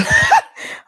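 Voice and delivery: high loud real laugh; burst of laughter. Real, not acted. Guest laughing at an unexpected ancestry claim, loud and high.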